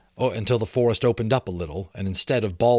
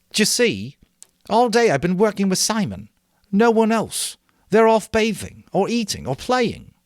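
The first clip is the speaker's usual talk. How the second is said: high, loud weary complaint; frustrated boy; 10 semitones higher, 6 dB louder than usual. A narrator's reading. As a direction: vent about days of wasted work. Ralph complains about others.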